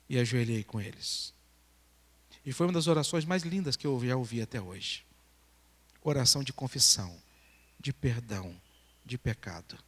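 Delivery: low, subdued storytelling with long pauses; solemn testimony. Real, not acted. Preacher recounting a teenage girl's hidden abortion, solemn testimony. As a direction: drop the voice and tell it gravely. Solemn telling of confession prayer.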